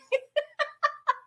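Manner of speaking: a burst of high-pitched laughter; cracking up at her own joke. Real, not acted. Host laughing at her Nico joke, high laugh.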